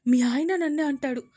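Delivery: loud, aggrieved whine; hurt complaint. Acted. Daughter complaining to mother, aggrieved and loud. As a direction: complain bitterly.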